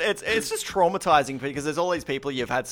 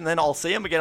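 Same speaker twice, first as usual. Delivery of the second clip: ordinary talk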